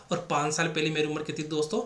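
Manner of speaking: ordinary talk, nothing marked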